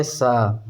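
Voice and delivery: सा with teeth together, hissing; fricative demo. Shown as a demonstration. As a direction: hold the सा through closed teeth. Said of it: "होठ को, दांत को जोड़ना पड़ेगा". (दांत जोड़कर सा)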